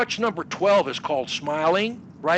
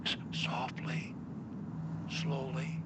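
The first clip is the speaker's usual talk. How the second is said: soft, breathy, slower; 4 semitones lower, 15 dB quieter, slower than usual. Shown as a demonstration. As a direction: say it gently, barely voiced. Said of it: "we're gonna say".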